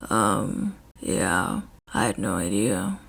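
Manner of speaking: very low, soft grumble; Shota's deep quiet voice. A narrator's reading. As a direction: mumble it, a little ashamed. Shota lies awkwardly.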